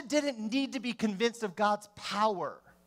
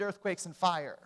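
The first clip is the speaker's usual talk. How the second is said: ordinary talk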